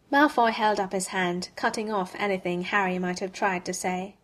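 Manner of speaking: ordinary delivery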